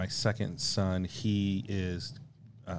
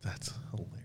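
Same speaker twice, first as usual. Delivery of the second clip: soft whispered aside; breathy amused whisper; 11 dB quieter than usual. Real, not acted. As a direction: whisper it to yourself. Aside after classified remark.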